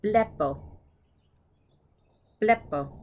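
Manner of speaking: "Blepo" stressed up front; stress on first syllable. Shown as a demonstration. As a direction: hit the start of "Blepo", drop on the end. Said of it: "it's on the first syllable".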